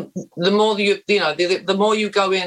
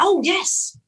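hammed-up Australian accent, much higher; fake Australian accent; 5 semitones higher than usual. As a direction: bluff through it in a high, put-on Australian accent. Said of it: "is that an authentic Australian accent?".

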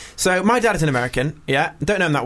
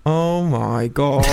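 dad's dismay; much slower than usual. Retold or imitated. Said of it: "I just saw his face go".

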